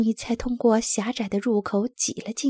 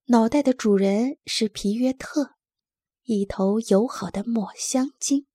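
ordinary delivery, nothing marked

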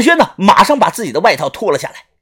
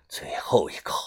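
entranced whisper, lower and softer; whispering to himself; 12 semitones lower, 13 dB quieter than usual. A narrator's reading. Old Fu whispers an apology to his dead daughter, entranced. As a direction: breathe the words to yourself, lost. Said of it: "自言自语的说道".

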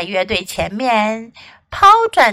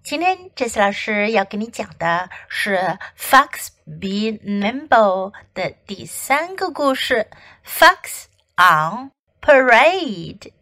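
usual speaking voice

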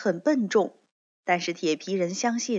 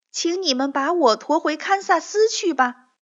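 put-on higher, louder pleading girl voice; girl's plea; 8 semitones higher, 5 dB louder than usual. Dorothy begging the monkeys to carry her home, higher pleading voice. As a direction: beg the monkeys as a young girl.

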